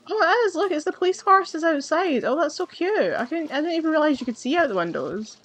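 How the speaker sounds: high, delighted coo over a cute horse; spotting a police horse through the window, cooing with delight. Real, not acted. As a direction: gush like you just saw a puppy.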